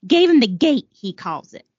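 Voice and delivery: put-on a light try at a Brooklyn accent; old Brooklyn accent, barely there. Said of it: "I'm gonna read it with a Brooklyn accent".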